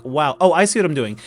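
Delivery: ordinary talk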